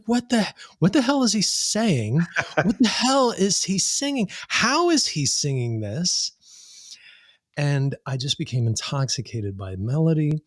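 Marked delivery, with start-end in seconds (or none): 0.0-6.3 high-pitched, astonished outburst; amazed disbelief. Retold or imitated. Guest recalling first hearing the falsetto, high incredulous amazement. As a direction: relive being totally baffled, voice shooting up.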